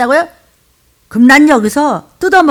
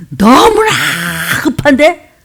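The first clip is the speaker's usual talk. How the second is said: shouted much higher and louder; preacher's urgent yell; 5 semitones higher, 5 dB louder, slower than usual. Real, not acted. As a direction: cry out with urgency. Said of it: "악을 써야 돼".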